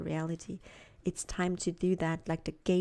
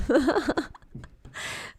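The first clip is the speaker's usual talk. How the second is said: laughing; 9 semitones higher, 6 dB louder than usual. Real, not acted. Laughs at own reading.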